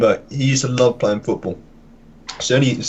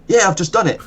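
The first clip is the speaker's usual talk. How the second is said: much higher, playing innocent; 9 semitones higher than usual. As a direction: chirp it out as an obvious fib. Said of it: "So he's like".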